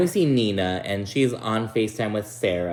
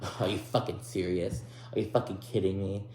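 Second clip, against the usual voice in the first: hushed mock indignation; 2 semitones lower, 8 dB quieter than usual. Imitating Sarah on hearing someone's upset, softly indignant. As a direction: imitate her seething under the breath.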